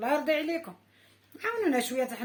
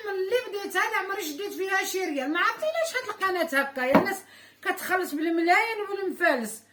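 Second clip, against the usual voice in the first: heated complaint; 6 semitones higher, 3 dB louder than usual. Real, not acted.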